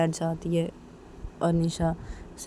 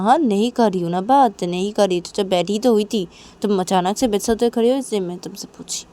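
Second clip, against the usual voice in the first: raised, exasperated retort; irritated defence; 2 semitones higher, 6 dB louder than usual. Acted. Manisha explaining why she asked Nisha, irritated. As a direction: justify yourself loudly and crossly.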